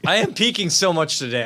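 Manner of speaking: ordinary talk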